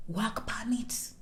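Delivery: slow, very soft whisper; hushed, drawn-out confidential aside; secretive whisper. Pretend. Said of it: "Let's whisper".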